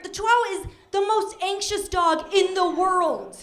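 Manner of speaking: ordinary talk, nothing marked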